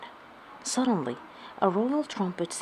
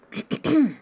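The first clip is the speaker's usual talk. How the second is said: high loud throat clear; 8 semitones higher, 6 dB louder than usual. A narrator's reading. King clearing his throat for attention. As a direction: clear the throat to get noticed. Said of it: "the King cleared his throat".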